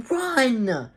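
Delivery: high, loud yelled command. A narrator's reading. Dan telling the family to run, yelling. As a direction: yell it out as an urgent order. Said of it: "Dan yelled".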